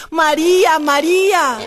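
high, loud desperate call; anguished loud cry of a name; screaming a name. Retold or imitated. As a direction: scream for your lost child. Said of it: "And I screamed".

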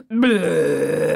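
loud, long rolling burp; exaggerated hawk belch; huge belch. A narrator's reading. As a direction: let out a drawn-out burp. Full hawk burps.

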